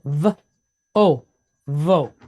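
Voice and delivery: v then o, then blended as vo; sounding out vo. Shown as a demonstration. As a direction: build "vo" sound by sound, slowly. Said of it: "it's pointed cholem wa".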